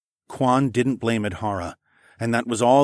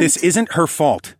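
raised, slow, emphatic defence; stern; 8 semitones higher, 5 dB louder, slower than usual. A narrator's reading. Narrator defends the alien against accusation, stern. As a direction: cut in sternly, louder and higher. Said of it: "I said more sternly".

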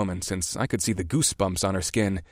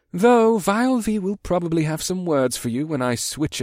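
lightly voiced soldier, pitched up; a hint of a soldier's character voice; hawk-faced soldier; 8 semitones higher than usual.

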